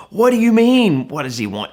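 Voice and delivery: play-acted incredulous protest; mock disbelief. Disciple questions Jesus about the blind man, mock disbelief. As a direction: start high and loud, then drop.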